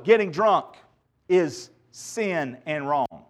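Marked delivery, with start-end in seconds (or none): none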